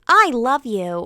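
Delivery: hint of a stuffed toy voice box; talking toy bear. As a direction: chirp it like a squeezed plush. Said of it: "It's like".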